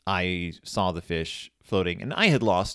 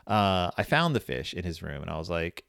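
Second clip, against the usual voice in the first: usual speaking voice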